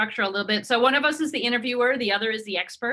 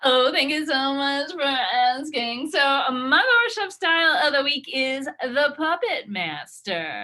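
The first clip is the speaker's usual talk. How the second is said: lightly voiced gushing guest, a bit higher; bubbly guest; 5 semitones higher, 4 dB louder than usual. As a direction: guest playing up her trendy style. Guest character continues.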